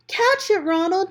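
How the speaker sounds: high, loud urgent shout. Acted. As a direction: yell it as the ball flies. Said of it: "Tom shouted".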